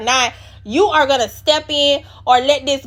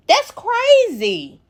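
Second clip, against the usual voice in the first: disbelief; 8 semitones higher, much slower than usual. Real, not acted. Disbelief at the cast's fight.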